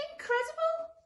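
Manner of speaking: very high, astonished monster voice; high-pitched wonder; amazed monster. Acted. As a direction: marvel in a squeaky-high character voice. First monster amazed at the sight.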